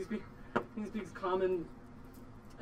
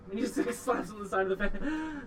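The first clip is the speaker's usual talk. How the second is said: ordinary talk, nothing marked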